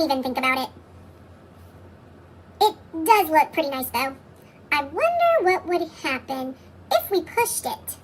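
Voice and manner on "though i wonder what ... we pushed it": usual speaking voice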